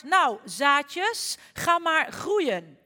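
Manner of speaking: stretched-out loud urging; said very loudly. Acted. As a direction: call it out loud and slow at the ground. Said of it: "zei heel hard".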